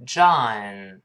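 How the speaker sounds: "John" long, open ah and a light tongue-tip n; American pronunciation. Shown as a demonstration. Teacher models the name for students, long open American "John". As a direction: draw out "John", keeping the tongue root still. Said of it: "跟老师读一遍".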